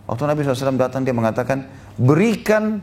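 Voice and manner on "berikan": sharp, higher bark of a demand; blunt demand. Retold or imitated. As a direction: order it like a rude beggar. Bedouin demanding charity rudely.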